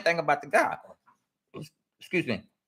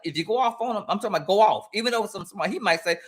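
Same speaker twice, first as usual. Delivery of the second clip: ordinary talk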